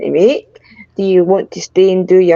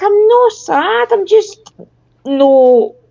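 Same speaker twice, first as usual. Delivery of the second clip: pitched way up, dragged-out complaint; high, slow mock protest; 9 semitones higher, slower than usual. Answering people who nag her to smile, in a high slow whine. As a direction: stretch it out high like a sulky kid. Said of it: "and I'd be like".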